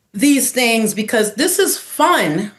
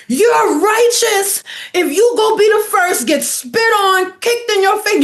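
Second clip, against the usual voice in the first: much higher, slow, preachy voice; pitched-up preachy elder, hammed up; mock preachy elder; 10 semitones higher than usual.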